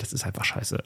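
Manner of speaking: whispered frustrated curse. Real, not acted. Frustrated aside about coach missing.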